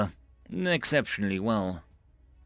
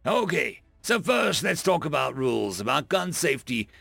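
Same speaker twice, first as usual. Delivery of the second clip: drill instructor; 5 semitones higher than usual. Acted. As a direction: bark it at the recruits. Instructor explains gun rules.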